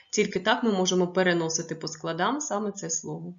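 normal talking voice

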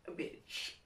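whispered naughty confession. Acted. Whispering her confession to viewers. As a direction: drop to a breathy secret whisper.

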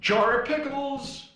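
high, loud, slow delighted call; excited. Real, not acted. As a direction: announce it with glee. Excited about found item.

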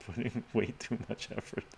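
words broken up by laughter; laughing. Real, not acted. Streamer joking to viewers about the PhD, laughing.

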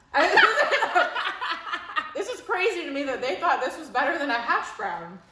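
loud, high, laughing through the complaint; half-mad rant cracking into laughter; laughing outrage. Real, not acted. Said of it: "now I'm getting mad".

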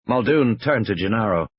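ordinary delivery

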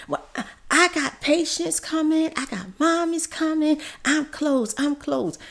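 high, hurried mock excuses; hammed-up dentist saying he's closed; mock flustered dentist. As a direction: imitate him brushing them off.

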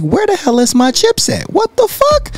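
mounting high-pitched disbelief; disbelieving outburst. Retold or imitated. Chips missing, retold outrage.

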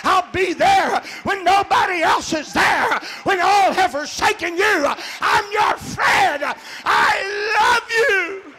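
shouted, rising promise from God; God's promise, preached. Acted. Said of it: "he says".

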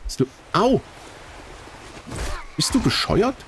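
high, shocked shout; outraged yell. Real, not acted. Hit by enemy, yelling at it.

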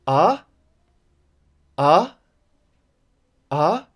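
AH swept upward; each short AH rises like a question; rising tone. Shown as a demonstration. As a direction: lift every AH from mid to high. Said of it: "we can call it a rising tone".

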